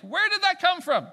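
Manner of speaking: high, loud astonished question; incredulous shout. Real, not acted. Preacher marvels at Peter's transformation to congregation, shouting.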